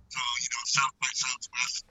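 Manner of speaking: muffled bad mic; down a well. Real, not acted. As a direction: sounds far away. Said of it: "It sounds like he's at the bottom of a well".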